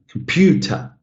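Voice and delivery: comPUter with PEW standing out; clear, lifted PEW, weak ends in "computer"; stressed second syllable. Shown as a demonstration. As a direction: open up on the middle of "computer". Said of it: "when I say the stressed syllable".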